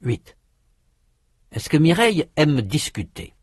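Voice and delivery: usual speaking voice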